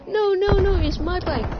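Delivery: high, loud insistent no no no; thief's loud protest. Retold or imitated. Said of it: "He was like".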